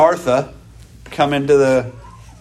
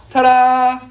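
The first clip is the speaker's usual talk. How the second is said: bright showy ta-da, higher and louder; mock ta-da; 7 semitones higher, 4 dB louder than usual. Preacher hams up a showy ta-da. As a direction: fling it out like a magic reveal.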